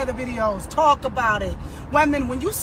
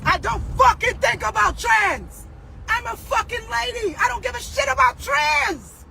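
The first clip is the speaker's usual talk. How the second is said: angry shout; 6 semitones higher than usual. Real, not acted. Angry rant at viewers.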